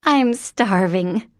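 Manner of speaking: put-on slow, loud childlike declaration; announced. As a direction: proclaim it to the room. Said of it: "Mary Elizabeth announced".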